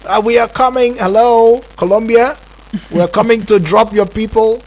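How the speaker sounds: high, loud radio-style hail; hailing aircrew. Acted. Acting out the deporting plane calling Colombia.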